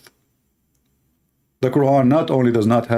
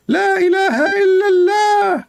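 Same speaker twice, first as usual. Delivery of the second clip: hammed-up high chant; Liya Dawa; 3 dB louder than usual. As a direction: impersonate in an absurdly high voice. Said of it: "This is the voice of Liya Dawa".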